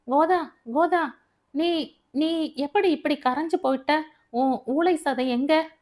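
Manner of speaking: high-pitched, halting disbelief; astonished. Acted. Siva astonished at slimmed-down Godha. (ஆச்சரியத்தில் திக்கித் திணறும் குரல்)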